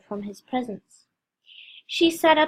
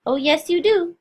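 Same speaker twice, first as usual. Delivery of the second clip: higher and louder, merry contradiction; cheerful; 7 semitones higher, 4 dB louder than usual. A narrator's reading. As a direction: playfully overrule her. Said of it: "he said cheerfully".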